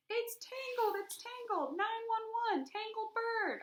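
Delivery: high screeching holler. Acted. Girl hollering for help with bird. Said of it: "I hollered".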